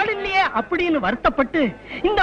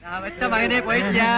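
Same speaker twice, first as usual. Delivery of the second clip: mock mourning wail; 3 semitones lower than usual. Fake mourner resumes the lament.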